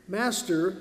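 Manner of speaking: usual speaking voice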